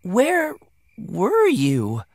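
astonished mother's question; crying out. A narrator's reading. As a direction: leap up high on the first word, drawn out. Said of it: "she cried".

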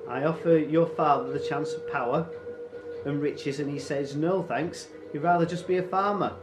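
high, loud, mock-wounded complaint; aggrieved raider chief. Raider complains of refused offers, mock-aggrieved. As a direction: rant as if badly wronged by their ingratitude.

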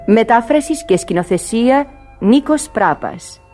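ordinary talk, nothing marked

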